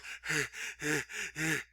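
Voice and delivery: repeated heavy breaths; imitated panting. Imitating a wrestler running back to the arena, mock panting. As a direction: huff like someone running.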